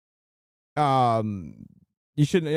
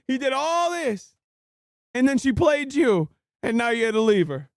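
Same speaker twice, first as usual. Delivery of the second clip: incredulous; 6 semitones higher than usual. Real, not acted. Mocking what he lost.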